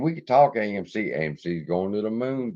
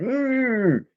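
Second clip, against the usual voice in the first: dog's grumble. Retold or imitated.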